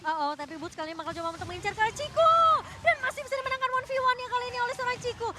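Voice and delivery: loud, hyped play-by-play call; fighting game announcer. Acted. Live esports play-by-play. (komentator pertandingan yang heboh)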